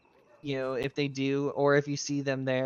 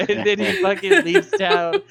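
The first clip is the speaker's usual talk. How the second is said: real laughter bursting through the words, much higher and louder; laughing hard; 12 dB louder than usual. Real, not acted. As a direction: crack up while telling the punchline. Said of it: "but I fucking died".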